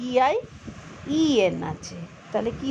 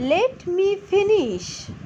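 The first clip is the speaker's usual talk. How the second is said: slow model reading; 7 semitones higher, much slower than usual. Shown as a demonstration. Teacher slowly modelling Let me finish for students. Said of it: "পড়ো এবার তোমরা".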